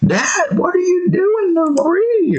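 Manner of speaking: very high-pitched cartoon boy voice; high, squealed question to Dad; Bobby. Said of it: "try to do Bobby's voice".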